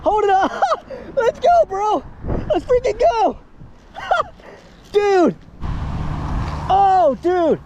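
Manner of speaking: high hyped-up shouting; gym bro. Real, not acted. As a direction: cheer like you just won. Landing the gator, cheering.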